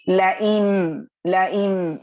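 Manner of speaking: "लइन" said loud and slow as a correction; emphasised correction. Shown as a demonstration. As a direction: stress the corrected word "लइन". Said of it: "sorry". (ज़ोर देकर सुधारा हुआ "लइन")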